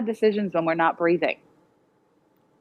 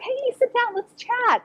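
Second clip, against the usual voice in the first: breezy friendly invite; slower than usual. Retold or imitated.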